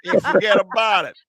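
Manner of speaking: high, loud mock Italian-American wiseguy; Italian-American accent. Host teasing about the accent, loud mock Italian-American. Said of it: "This fucking accent right here".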